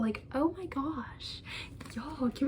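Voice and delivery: slow awed whisper; whispered gush. Real, not acted. Whispering in awe over the tulip cover. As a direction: breathe it out in awe.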